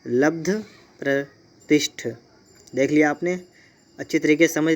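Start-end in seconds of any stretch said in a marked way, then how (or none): 0.0-2.1 लब्ध then प्रतिष्ठ, drawn out slowly with a clear pause between the words and no final आ; careful spelling demo. Shown as a demonstration. Teacher to exam students, slow careful pronunciation. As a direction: sound out प्रतिष्ठ slowly, ending on the bare ठ. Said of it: "ये होता है".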